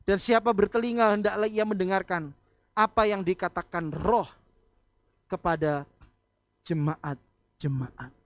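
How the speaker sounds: loud preached scripture reading, slowing at the end; preaching scripture. Acted. Pastor proclaiming scripture to the congregation, loud and slowing.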